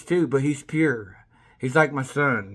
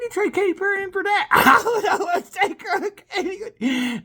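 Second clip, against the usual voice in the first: much higher giddy laugh. Real, not acted. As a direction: laugh with delight. Giddy about Katy.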